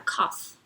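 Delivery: "cough" ending softly on F; one syllable "cough", no vowel after the F; soft final F. Shown as a demonstration. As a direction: let "cough" trail into a gentle F. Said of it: "o final a pronúncia vai ser bem mais suave".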